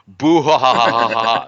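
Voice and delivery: loud, hammy villain cackle; evil villain laugh. Pretend. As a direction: throw back your head and cackle.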